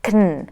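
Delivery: "kn" with no vowel, k straight into n; reduced "can". Shown as a demonstration. Teacher shows what replaces can, reduced "kn". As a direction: squeeze "kn" down to k plus n. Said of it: "exchange it with".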